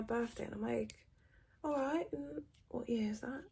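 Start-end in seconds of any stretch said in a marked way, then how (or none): 1.6-3.4 cheeky ask. Retold or imitated. Cheeky age question.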